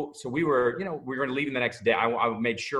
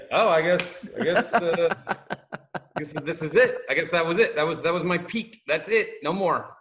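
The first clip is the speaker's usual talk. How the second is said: higher, louder hammed-up defeat; mock resignation; 7 semitones higher, 5 dB louder than usual. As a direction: play it as a jokey career-over sigh. Mock resignation, career over.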